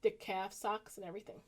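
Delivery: ordinary delivery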